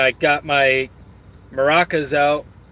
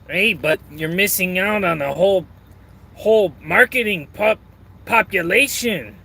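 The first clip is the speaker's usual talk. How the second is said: put-on higher-pitched Mexican accent; imitated Mexican accent, pitched up; 4 semitones higher than usual. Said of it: "trying to do an actual Mexican accent".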